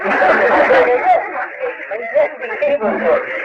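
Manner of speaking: long high laugh tailing off; real laugh. Real, not acted. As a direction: laugh freely at the story. Amused at door request.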